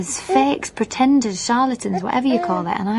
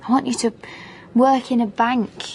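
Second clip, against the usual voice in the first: usual speaking voice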